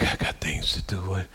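whispered impatient grumble; muttered under breath. Retold or imitated. Whispering impatiently to herself while pacing the floor. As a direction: hiss it to yourself, fed up with waiting.

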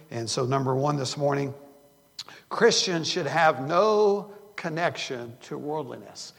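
preaching. Acted. Pastor announcing his first point to the church, preaching loud.

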